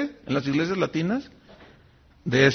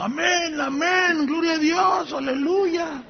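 imitating cheering pastors; 10 semitones higher, slower than usual. Pastors cheering the money proposal.

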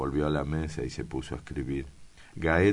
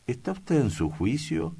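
incredulous exclamation; 11 semitones higher than usual. Acted. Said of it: "exclamó Arana".

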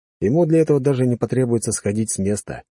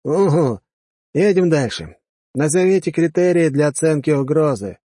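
commanding officer; 7 semitones higher, 3 dB louder than usual. Acted. Officer questions the cadets.

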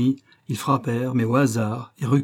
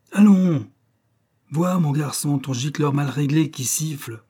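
a sudden, much higher and louder bark; sharp command; 4 semitones higher, 4 dB louder than usual. Acted. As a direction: jolt him with an order. (le détective lance un ordre brusque, bien plus fort)

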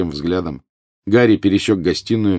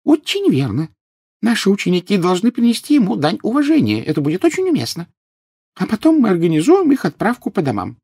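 high, piping little professor; very high character voice; Flitwick, squeaky; 4 dB louder than usual. A narrator's reading. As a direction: squeak it out earnestly. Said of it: "пропищал профессор Флитвик".

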